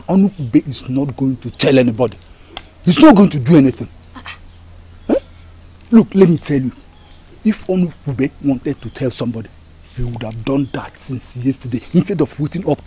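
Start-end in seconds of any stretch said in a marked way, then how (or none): none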